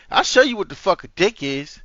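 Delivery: ordinary talk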